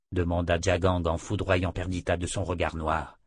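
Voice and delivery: plain reading voice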